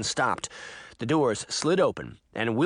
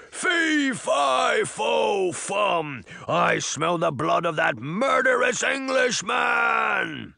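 high, drawn-out monstrous roar; bellowing giant; 9 semitones higher, much slower than usual. A narrator's reading. Giant threatening Jack, bellowing. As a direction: roar the rhyme, stretching each word. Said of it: "The giant bellowed at Jack".

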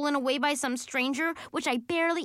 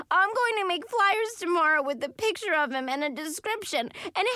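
tearful determination; 7 semitones higher than usual. A narrator's reading. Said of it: "still crying".

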